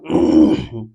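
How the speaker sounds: short rough throat clear. Real, not acted. Teacher pauses before writing an example, clearing throat. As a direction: clear the throat between words.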